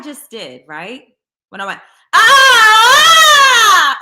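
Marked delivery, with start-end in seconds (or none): 2.1-4.0 long, very loud high sung cry; vocal demo of a sustained belt way up high. Shown as a demonstration. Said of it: "all the way up there".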